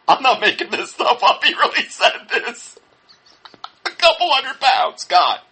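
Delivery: high voice breaking up with real laughter; incredulous laughing. Real, not acted. Critic insisting the preacher really said it, laughing in disbelief. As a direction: laugh helplessly through the words.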